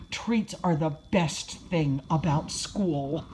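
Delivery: plain reading voice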